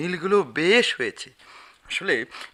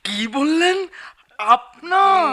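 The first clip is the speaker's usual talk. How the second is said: high, drawn-out incredulous question; shocked disbelief; 11 semitones higher, 4 dB louder, much slower than usual. Acted. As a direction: stretch it out in stunned disbelief. Shocked at the unexpected claim.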